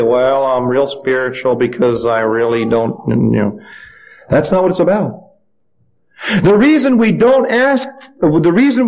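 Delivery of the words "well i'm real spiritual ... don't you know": slow, low mock-spiritual drone; low and sluggish, putting on piety; joyless pious person. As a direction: mimic someone solemnly over-spiritual, dragging the words. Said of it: "people say".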